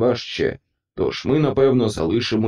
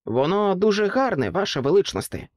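higher, polite little voice; the pig, lightly voiced; 7 semitones higher than usual. A narrator's reading. Pig politely answers the king, a higher little voice. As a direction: answer timidly but politely. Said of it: "відповіла різдвяна свинка".

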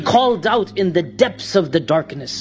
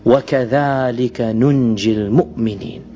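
Quranic recitation; 8 semitones lower, much faster than usual. Acted.

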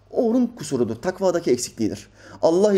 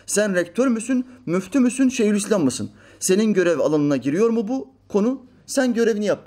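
higher-pitched indignant questioning; imitated indignant challenge; challenging rebuke; 6 semitones higher than usual. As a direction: challenge him like a scolding critic. Acting out Metiner's challenge to rector.

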